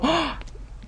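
high, sharp gasp at own mistake; startled intake of breath. Real, not acted. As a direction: gasp as you catch your slip. Catches her own slip.

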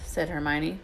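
plain reading voice

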